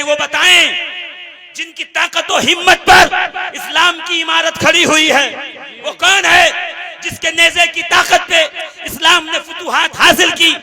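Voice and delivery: loud rhetorical sermon question; full-force preaching. Acted. Preacher asks whose strength built Islam, impassioned oratory. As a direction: declaim it to rouse the crowd.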